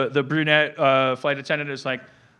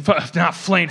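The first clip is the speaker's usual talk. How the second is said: ordinary delivery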